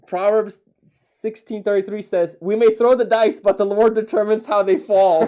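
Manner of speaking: loud, high proclamation of a verse; big, emphatic verse reading; proclaiming scripture. Real, not acted. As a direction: declaim it like a punchline, loudly. Triumphantly reads verse aloud to friend.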